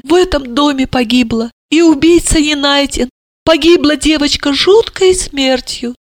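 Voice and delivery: distressed mother. Acted. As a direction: lament the cursed son. Mother tells of curse.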